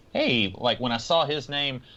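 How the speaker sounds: usual speaking voice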